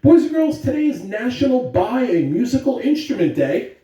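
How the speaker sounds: bright, loud school PA announcement; announcer voice. Acted. Principal greeting the school on the morning announcements, loud announcer voice. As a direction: greet the whole school over the intercom.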